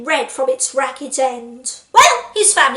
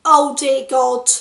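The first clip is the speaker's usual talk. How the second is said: ordinary talk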